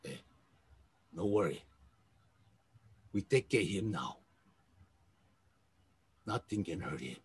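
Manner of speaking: soft, low, hushed reassurance; gentle comfort. Acted. Medic softly reassures the grieving soldier, hushed and low.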